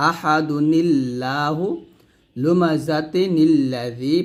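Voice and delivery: slow আহাদুনিল্লাহু, নি linking into লা; joined recitation. Shown as a demonstration. Teacher correcting and rereading the joined form. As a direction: link the nun into the lam, slowly. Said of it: "নীল লা হবে এটা".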